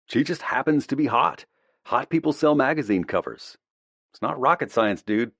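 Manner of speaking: put-on brash young jock. A narrator's reading. Jack's tactless remark voiced.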